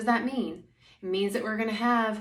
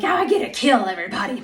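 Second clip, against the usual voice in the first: fast, louder, cartoonish glee about killing; gleeful bloodthirsty warrior; 3 semitones higher, 8 dB louder, much faster than usual. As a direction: play a gung-ho brute, tongue in cheek. Mocking a bloodthirsty warrior attitude.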